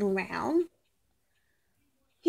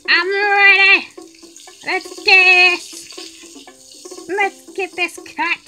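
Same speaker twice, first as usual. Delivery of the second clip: loud eager cheer; 8 semitones higher, 9 dB louder, much slower than usual. Acted. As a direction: fire yourself up, ready to go. Eager cook psyching up.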